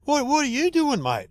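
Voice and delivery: mock the nosy young bloke's high question; young bloke at the door. Young bloke interrupting a podcast intro, high and startled. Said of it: "this young piss bloke opened the door and goes".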